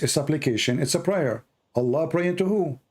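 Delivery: usual speaking voice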